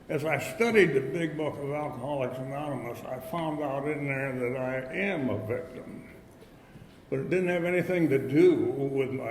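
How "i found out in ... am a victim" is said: ordinary talk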